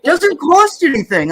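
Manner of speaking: imitating Ron. Teasing quote of a friend's catchphrase, lightly mocking. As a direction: chirp it like a cheerful know-it-all. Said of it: "that's especially what Ron says all the time".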